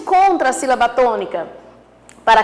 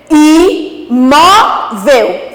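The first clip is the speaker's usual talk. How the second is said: "Imóvel" very slowly in three loud pieces, "i, mó, vel"; syllable by syllable; 5 dB louder, much slower than usual. Shown as a demonstration. Teacher locating the tonic syllable, "Imóvel" slowly split and loud. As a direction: pause after each syllable of "Imóvel", keeping it loud. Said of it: "o mó como tônica".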